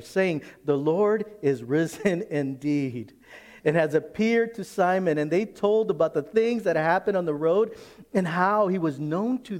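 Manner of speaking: forceful, projected Bible reading; preached scripture reading. Real, not acted. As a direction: proclaim the verse to the congregation.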